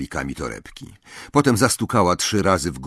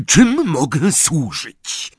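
put-on slow, high, odd old man voice; drawn-out eccentric host; 7 semitones higher, 4 dB louder, much slower than usual. A narrator's reading. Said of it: "Ksenofilius greets guests". (dziwaczny starszy pan, powoli)

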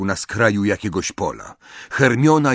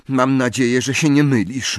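ordinary delivery, nothing marked

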